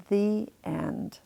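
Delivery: usual speaking voice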